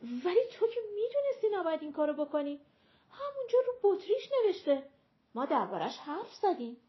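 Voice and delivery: high, loud outburst, fast; reckless shout. A narrator's reading. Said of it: "بی محابا فریاد زد".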